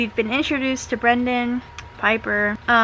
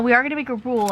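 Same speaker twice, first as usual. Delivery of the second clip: normal talking voice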